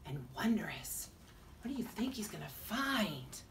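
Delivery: hushed storybook whisper. A narrator's reading. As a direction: breathe it like a secret. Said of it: "whispered narration".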